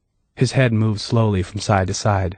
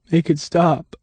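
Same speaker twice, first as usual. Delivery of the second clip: begged; 8 semitones higher, slower than usual. A narrator's reading. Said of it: "Dave begged".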